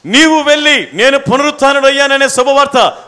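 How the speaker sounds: loud interpreted proclamation; impassioned preaching. Real, not acted. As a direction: ring the good news out loudly to the congregation.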